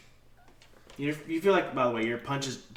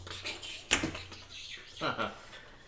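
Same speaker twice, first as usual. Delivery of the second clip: crackling zap noises made by mouth; voiceless crackle sound effect; imitated electric crackle; 3 semitones higher than usual. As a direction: sputter like electricity arcing over water.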